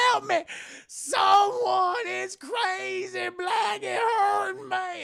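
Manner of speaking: very high, slow whimpering cries for help; mock squealing. Overseer crying out for help while being beaten. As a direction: wail like a coward being beaten. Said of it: "squealing like a bitch".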